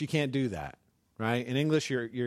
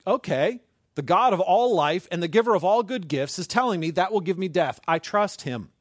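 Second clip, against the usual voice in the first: trusting believer; 4 semitones higher, 6 dB louder than usual. Acted. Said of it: "you're like".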